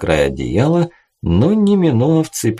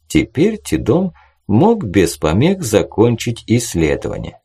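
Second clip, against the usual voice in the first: ordinary delivery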